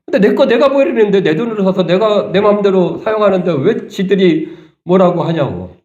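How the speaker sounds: indignant protest. Pretend. Indignant retort on the woman's behalf.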